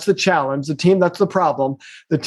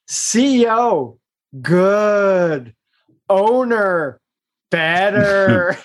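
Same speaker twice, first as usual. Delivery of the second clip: imitating Marshall's blunt verdict; much slower than usual. Coach imitating a mentor advising a CEO, slow mock pronouncement. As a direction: spell out the verdict word by word, like a wise mentor. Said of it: "he'll say".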